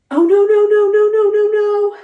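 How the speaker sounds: very high, loud, frantic repeated no's; retelling the husband's terrified outburst at full pitch; panicked cries. Retold or imitated. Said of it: "I hear Byron go".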